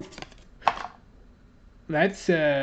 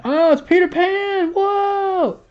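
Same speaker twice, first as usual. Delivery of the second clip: much higher, louder delighted squeal; excited squeal; 6 dB louder, slower than usual. Real, not acted. Opener squeals excitedly at pulling Peter Pan.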